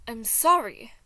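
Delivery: sudden loud I'm sorry. Acted. Roman loudly apologizing to Thomas.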